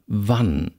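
"wann" with a brief a; short vowel. Shown as a demonstration. Lecturer reads minimal pairs, short vowel.

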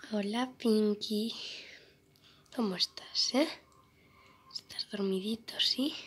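soft whisper to a sleeping pet. Real, not acted. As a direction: hush it so she stays asleep. Susurra al gato dormido. (susurrando para no despertar a Pinky)

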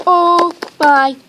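loud, high, drawn-out cry of delight; thrilled, swooning outburst; overwhelmed excitement. Real, not acted. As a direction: wail it out, dying of cuteness. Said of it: "I'm dying right now".

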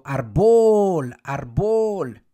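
"árbol" shouted as ar-BOL, loud on bol; wrongly stressed call. Shown as a demonstration. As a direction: get árbol wrong by booming the last syllable. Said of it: "Qué tal si me equivoco".

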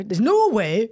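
careful, projected Geordie accent attempt. Shown as a demonstration. Practising the reality-show line, careful Geordie accent. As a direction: have another careful go at Geordie. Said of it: "That was good".

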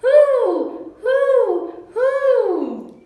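high, loud falling hoots; three long, high owl calls; owl hooting. A narrator's reading. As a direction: hoot like an owl. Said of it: "The owl says".

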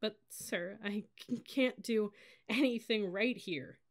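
high, halting tearful protest; slow, breaking admission; sobbing. A narrator's reading. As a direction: stammer it through sobs. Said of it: "I let out a huge involuntary sob".

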